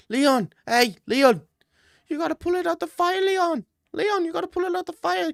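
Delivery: frantic high-pitched cornerman urging a fighter on; squeaky falsetto coach impression, pleading from the corner; Leon Edwards' coach. Coach to Leon, "you gotta pull it out the fire", hammed-up high urging. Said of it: "Could you imagine Leon Edwards' coach".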